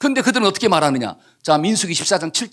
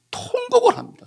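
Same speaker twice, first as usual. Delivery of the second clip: mock wail. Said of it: "엉엉 하고 통곡한".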